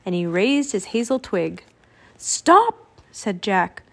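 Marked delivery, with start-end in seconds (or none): 2.2-2.8 sharp, high, louder command to stop; urgent cry. A narrator's reading. Jack trying to halt Teddy's spell, urgent cry. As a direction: cut in with a high, urgent call.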